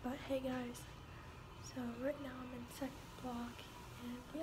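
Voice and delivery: hushed voice in class; quiet. Real, not acted. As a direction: keep it down at school. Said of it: "I'm gonna, like, be quiet".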